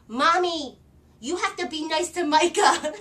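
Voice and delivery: put-on high, loud bossy little girl voice; a small child scolding her mother; little daughter.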